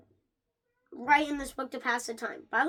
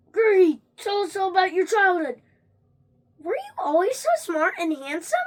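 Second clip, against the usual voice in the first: eager interviewer; 5 semitones higher, 6 dB louder than usual. Acted. Imagined fan interviewing famous Greg.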